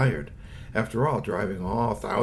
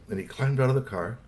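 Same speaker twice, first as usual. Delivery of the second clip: usual speaking voice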